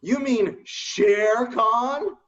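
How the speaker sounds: very slow, drawn-out "You mean Shere Khan?"; slow correction. Retold or imitated. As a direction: spell it out patiently for him. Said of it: "I'm saying".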